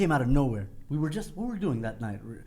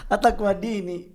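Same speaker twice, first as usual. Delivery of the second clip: higher, louder Swahili auntie; 7 semitones higher, 4 dB louder than usual. Retold or imitated. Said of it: "like a Swahili auntie".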